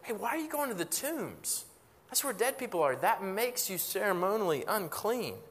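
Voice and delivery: mock alarm at going near the tombs; scandalized onlooker. Onlookers calling after Jesus heading to the tombs, lightly voiced alarm. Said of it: "would have turned and said".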